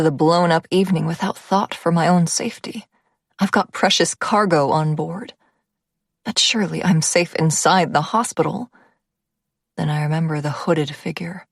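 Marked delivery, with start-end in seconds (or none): none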